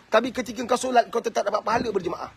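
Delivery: ordinary talk, nothing marked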